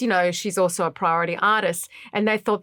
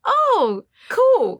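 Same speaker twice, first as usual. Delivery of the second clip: high, strained-polite acceptance; put-on high, agreeable tone; fake enthusiasm; 5 dB louder than usual. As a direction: pretend to approve while devastated. Hiding devastation from the producer.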